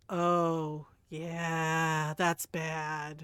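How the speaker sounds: low, drawn-out voice of someone finally getting it; mock dawning realization. Imitating people who just understood their insult, mock realization. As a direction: drop low and let it sink in.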